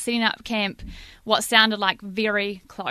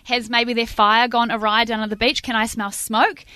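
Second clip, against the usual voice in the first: ordinary delivery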